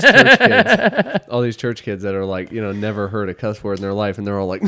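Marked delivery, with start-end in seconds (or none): 0.0-1.3 laughing hard, high and loud. Real, not acted. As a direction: squeeze it out through laughter. Laughing while telling story.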